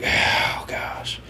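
huffed out on a whispered sigh; exasperated, voiceless puff of breath; puffy and angry. Acted. Miner giving in to the bartender's offer, huffy exasperation. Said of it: "get all puffy and angry".

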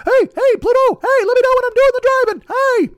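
very high, loud cartoon falsetto; Mickey Mouse. Being Mickey in his texts, squeaky cartoon eagerness. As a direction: squeak it with manic mock cheer. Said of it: "I have to be Mickey in my texts".

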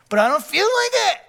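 put-on high, loud whining like a baby; whiny little baby. Whining back at the Holy Spirit like a baby. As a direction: whine it like a sulky toddler. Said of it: "like a little baby".

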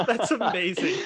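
loud, high, through real laughter; amused amazement. Real, not acted. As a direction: crack up in delighted disbelief. Cracking up at an embarrassing confession.

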